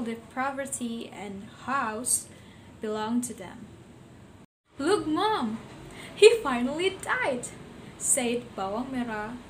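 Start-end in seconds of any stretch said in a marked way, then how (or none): 4.8-7.5 put-on gleeful high-pitched girl voice; happy. A narrator's reading. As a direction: crow it with delight.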